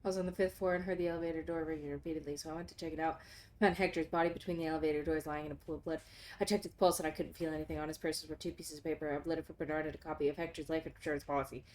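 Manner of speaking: low, soft reading of notes; quiet reading. Acted. Player reading game notes, low quiet reading. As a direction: read the clue log under your breath.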